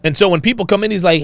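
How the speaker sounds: ordinary talk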